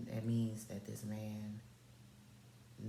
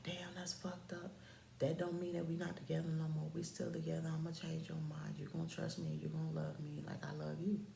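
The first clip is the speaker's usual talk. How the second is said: higher, hushed imitation of him; soft insistent suitor; 5 semitones higher, 6 dB quieter than usual. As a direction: coax her softly in a raised voice. Voices him insisting and coaxing.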